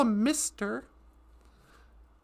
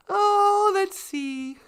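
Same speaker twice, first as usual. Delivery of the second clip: ordinary talk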